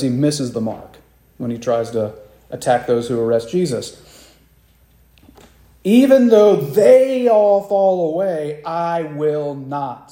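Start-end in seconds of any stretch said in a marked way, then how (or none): 5.8-10.1 Peter's vow. Acted. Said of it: "says Peter".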